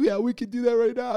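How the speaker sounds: high-pitched mock crying voice; imitating yourself crying while agreeing; imitated tearful voice. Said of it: "I'm sounding like".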